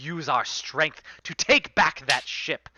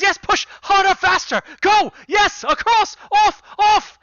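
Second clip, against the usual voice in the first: very high, loud cheering on; excited urging; 10 dB louder than usual. Acted. Captain cheering the crew on as they push, loud excited. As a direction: whip them on, thrilled.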